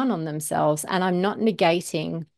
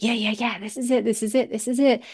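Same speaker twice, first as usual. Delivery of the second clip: slightly raised, eager repeated phrases; bubbling excitement; 4 semitones higher, faster than usual. Acted. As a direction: let a little excitement bubble up. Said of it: "that bubble of excitement raise up".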